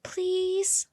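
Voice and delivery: high, wheedling please; a child's hopeful plea; pleading. Acted. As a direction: beg sweetly to be allowed. Annie begs to hold him.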